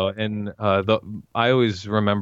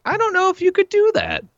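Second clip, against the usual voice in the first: imitating Jerry; 3 dB louder than usual. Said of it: "And Jerry's like".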